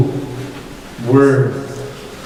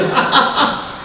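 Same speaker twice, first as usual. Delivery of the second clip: high, short mock laugh; a burst of put-on laughter, high-pitched; acted laugh; 10 semitones higher than usual. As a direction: imitate someone laughing along in play. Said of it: "and he's laughing".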